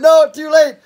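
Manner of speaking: high, loud playful too late; joking to the MP, high and loud; teasing refusal. Retold or imitated. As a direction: tease him that it's done. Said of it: "I went".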